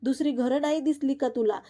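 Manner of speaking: ordinary delivery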